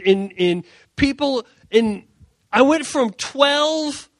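high, drawn-out, mounting exasperation; exasperated complaint. Acted. Young pastor complains to God in his car, exasperated. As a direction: list your efforts, stretching the key words.

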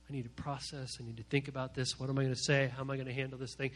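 low, hushed thinking aloud; quiet processing. Acted. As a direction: pull inward and puzzle it through softly. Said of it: "and I'm quiet".

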